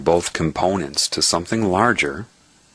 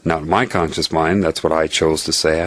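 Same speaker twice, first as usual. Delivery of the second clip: ordinary delivery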